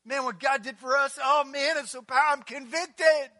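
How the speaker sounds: high, loud emotional exclaim; moved churchgoer. Acted. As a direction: gush about being moved. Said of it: "you're going".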